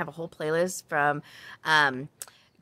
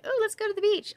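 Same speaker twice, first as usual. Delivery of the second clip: much higher, bubbly mock beach-girl voice. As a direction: parody a typical Cali girl. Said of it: "I am not like".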